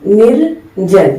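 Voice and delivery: निर्जन said slowly, syllable by syllable, r rolled into ज; निर्जन drawn out for the class; slow model word. Shown as a demonstration. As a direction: spell out निर्जन slowly, nir-jan. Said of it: "इसका शुद्ध रूप निर्जन".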